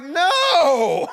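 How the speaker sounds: high, loud laughing no; shocked no. Retold or imitated. Hearing his wife agree, a shocked laughing no. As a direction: yelp the no in comic disbelief.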